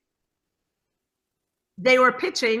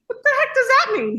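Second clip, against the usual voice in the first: much higher, louder baffled cry; 13 semitones higher, 5 dB louder than usual. Retold or imitated. Wife hearing about the Bloody Mary job, baffled. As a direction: throw up your hands in confusion. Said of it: "And I said".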